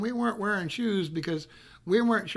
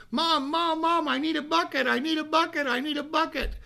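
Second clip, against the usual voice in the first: put-on higher, urgent child's plea to mom; eager kid begging; 8 semitones higher than usual. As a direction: beg Mom like an impatient five-year-old. Boy begs mom for bucket.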